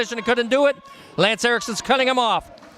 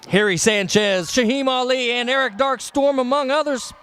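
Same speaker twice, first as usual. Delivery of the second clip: ordinary talk, nothing marked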